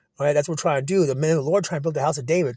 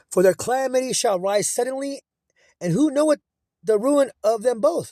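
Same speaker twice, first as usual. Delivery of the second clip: higher, solemn preacher's read, slowing at the end; scripture reading; 6 semitones higher than usual. Acted. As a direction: proclaim the verse from the pulpit. Reads scripture aloud.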